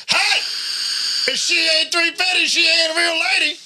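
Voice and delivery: high, hollered truck-driver impersonation; mock holler. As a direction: call it out high like the man in the truck. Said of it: "it was a fat man in a little truck".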